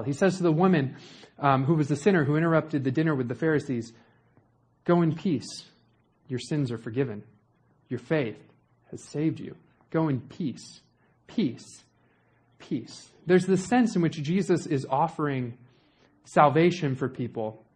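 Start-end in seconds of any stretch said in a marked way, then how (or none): 4.8-13.1 soft fading blessing. Retold or imitated. Jesus' words fading softly.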